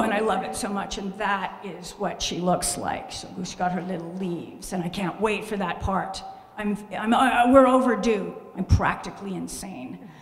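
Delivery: ordinary talk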